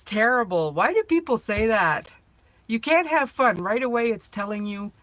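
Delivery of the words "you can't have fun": higher, louder indignant protest. Real, not acted. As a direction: protest it like it's unfair. Mocking grandmother saying.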